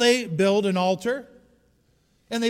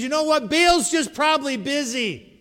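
mocking; 9 semitones higher, 7 dB louder than usual. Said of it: "he begins to make fun of them".

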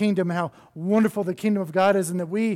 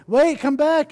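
high, louder call after the boat; 8 semitones higher, 6 dB louder, slower than usual. Acted. Baby bird calling after the boat, high and louder. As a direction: call out, wait come back.

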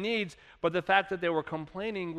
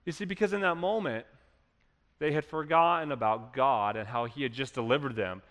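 usual speaking voice

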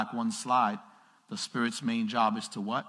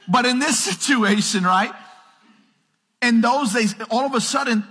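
full-force preaching; 8 semitones higher, 7 dB louder than usual. Acted. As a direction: proclaim it to the whole room.

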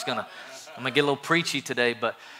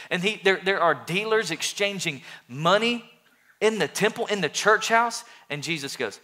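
building passion; 4 semitones higher than usual. Acted. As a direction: build it up like the fire is rising. Rising passion retelling the temple.

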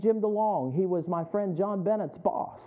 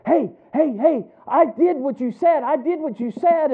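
higher and louder, slow, eager insistence; excited coworker; 8 semitones higher, 8 dB louder than usual. Retold or imitated. A coworker rushes into the cubicle, loud and eager. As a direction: burst in bursting with news. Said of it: "he came in there and he said".

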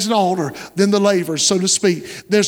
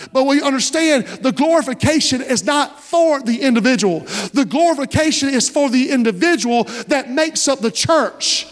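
higher-pitched, driving sermon delivery; impassioned preaching; 4 semitones higher than usual. Real, not acted. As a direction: preach it with building fervor to the congregation. Preaches at rising force to congregation.

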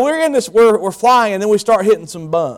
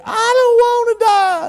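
very high, stretched-out wail; mock-terrified girl's scream; imitated scream. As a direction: shriek it slowly like a panicked passenger. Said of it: "She screamed".

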